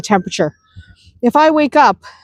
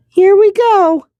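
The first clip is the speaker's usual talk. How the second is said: excited; 9 semitones higher than usual. Real, not acted. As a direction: announce the surprise babies. Finally got baby chicks.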